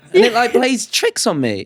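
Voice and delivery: ordinary talk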